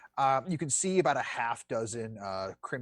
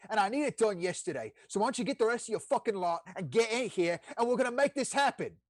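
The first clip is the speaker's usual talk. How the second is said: barking devil chef; 7 semitones higher than usual. Acted. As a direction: boss the newcomers around like a battlefield commander. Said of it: "he looks at you, he's like".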